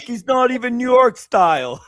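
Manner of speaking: mock fan complaint. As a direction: grumble like an outraged fan. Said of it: "the fans in New York are like".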